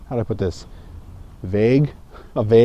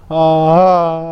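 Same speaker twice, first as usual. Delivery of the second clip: a projected, louder ah; louder voice demo; 2 semitones higher than usual. Shown as a demonstration. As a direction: push the ah out from the diaphragm. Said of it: "okay, it goes louder".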